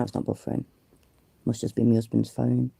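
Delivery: usual speaking voice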